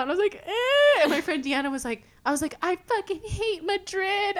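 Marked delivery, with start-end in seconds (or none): 0.5-1.0 high, loud imitated sob; mock bawling wail. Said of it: "started bawling my eyes out". 2.6-4.4 high, slow tearful whine; hammed-up wailing through tears; mock bawling. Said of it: "started bawling my eyes out".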